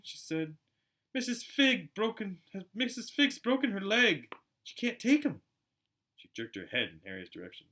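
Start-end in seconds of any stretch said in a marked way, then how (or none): 1.1-5.4 higher, louder and slow, stumbling anxiously; flustered wife fumbling the bad news; Aunt Petunia, high and slow, fretting to her husband; worried Aunt Petunia. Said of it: "looking both angry and worried".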